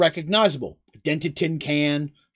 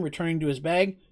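ordinary delivery, nothing marked

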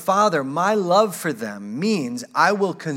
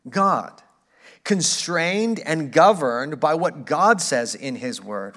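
ordinary talk

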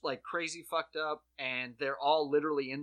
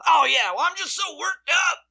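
very high and much louder, squeaky excuse; mock flustered squeak; flustered rioter; 14 semitones higher, 12 dB louder than usual. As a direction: sheepishly excuse himself in a squeal. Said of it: "He goes".

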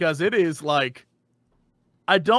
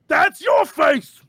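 very slow, higher shouting ogre; 8 semitones higher, much slower than usual. Acted. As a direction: announce it like a dim ogre. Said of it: "I'm like".

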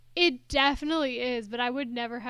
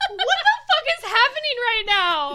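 high, loud, incredulous outcry; real bewilderment; 10 semitones higher, 6 dB louder than usual. Real, not acted. Co-host hears the wild casting reveal, bewildered outcry. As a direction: cry out as if nothing makes sense. Stunned by Andy Dick casting.

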